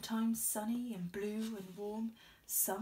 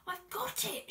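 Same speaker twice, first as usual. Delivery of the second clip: much higher excited snail cry; 11 semitones higher than usual. A narrator's reading. Snail finding a plan, high excited cry. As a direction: blurt out the idea. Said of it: "she cried".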